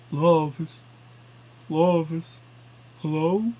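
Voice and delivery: lightly voiced eerie echo; a hollow echo of himself. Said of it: "My voice was echoing back".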